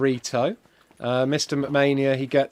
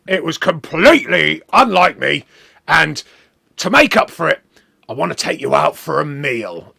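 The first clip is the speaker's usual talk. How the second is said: louder put-on cockney, grandly apologetic; wrestling boss in a mock cockney voice, inviting him to dinner; 6 dB louder than usual. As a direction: make amends like a brash cockney boss. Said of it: "He's a cockney".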